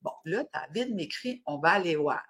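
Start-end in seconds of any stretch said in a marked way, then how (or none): 1.5-2.2 put-on low-pitched Acadian French accent; accent acadien. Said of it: "Hey, c'est-tu acadien?". (accent acadien, voix plus grave)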